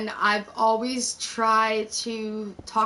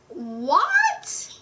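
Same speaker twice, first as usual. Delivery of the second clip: higher-pitched, stretched-out "what?"; retold disbelief; 7 semitones higher, 3 dB louder than usual. Woman recounting being accused of bullying her friend, incredulous. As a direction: act out shocked disbelief.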